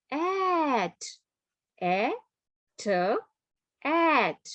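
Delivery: "a" and "t" sounded apart, then blended to "at"; sounding out words. Shown as a demonstration. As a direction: blend "a", "t" into "at" slowly. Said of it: "moving the finger, uh, you can say".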